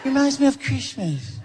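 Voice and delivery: high, gentle Michael impression with a laugh; [name]. Michael renaming Chris as Christmas, high and sweet. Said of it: "I go".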